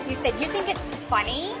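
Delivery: ordinary talk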